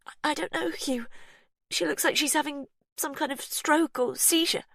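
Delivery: high, unsteady; shaken. A narrator's reading. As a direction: stammer it out. Verity shaken.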